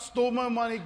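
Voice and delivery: ordinary talk, nothing marked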